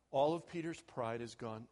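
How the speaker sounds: ordinary talk, nothing marked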